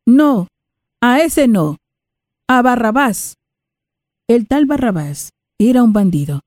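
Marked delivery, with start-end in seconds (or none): 0.0-3.4 raised mob shout; crowd shouting. Acted. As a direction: call out for Barrabás like an angry mob. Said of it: "todos ellos gritaron".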